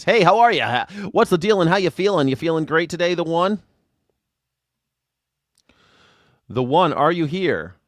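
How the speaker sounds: over-the-top radio host hello; loud cheery greeting. Real, not acted. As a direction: boom it out to the new guest. Greeting the guest loudly.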